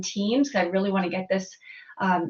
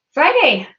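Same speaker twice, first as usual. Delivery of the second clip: excited; 6 semitones higher, 8 dB louder than usual. Real, not acted. Cheering happy Friday greeting.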